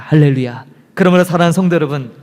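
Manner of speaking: normal talking voice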